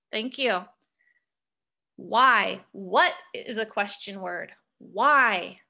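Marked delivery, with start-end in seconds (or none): none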